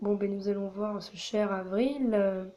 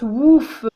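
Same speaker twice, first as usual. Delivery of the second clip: loud playful woof; dog bark; 3 semitones higher, 15 dB louder than usual. Pretend. She voices the dog villager Avril, a loud bark.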